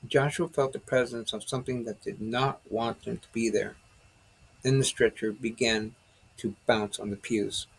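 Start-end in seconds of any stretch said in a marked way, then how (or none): none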